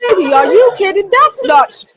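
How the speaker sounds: high, loud, fast mock Tim; imitating Tim. Host acts out Tim's shocked reaction. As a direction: squeal it like an incredulous old boxer. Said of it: "He went like this".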